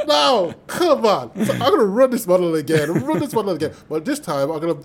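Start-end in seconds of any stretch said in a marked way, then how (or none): 0.1-1.4 exasperated disbelief. Retold or imitated. His disbelief at the fans.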